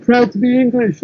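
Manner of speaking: lightly put-on proud voice; imitated patriot. Quotes proud English flag-wavers.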